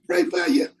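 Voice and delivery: high-pitched mock well-wisher; mock brush-off. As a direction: imitate a half-hearted offer of prayer. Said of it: "Don't be like".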